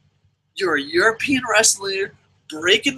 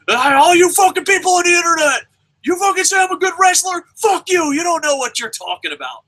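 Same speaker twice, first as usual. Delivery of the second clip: higher, loud shouted rant at fans; mock furious rant; 8 semitones higher, 4 dB louder than usual. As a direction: rage at the internet like a paranoid wrestler. Magnus voiced cursing internet fans.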